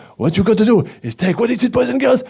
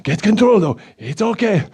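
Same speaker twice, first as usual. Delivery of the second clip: usual speaking voice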